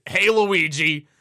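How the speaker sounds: slow, loud hail, lightly imitating game Mario; mock Mario hail with no Italian accent; accentless game Mario. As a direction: call out like an amateur game voice actor. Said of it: "bunch of guys just, just go, just going".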